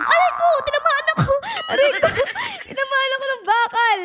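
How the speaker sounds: pained yelps with laughter; ouch, hit by a falling bar; hit hard crying out. Real, not acted. Hit by falling iron bars.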